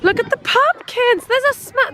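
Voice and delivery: high delighted exclamation; excited. Real, not acted. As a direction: gush over the pumpkins. Thrilled at pumpkins.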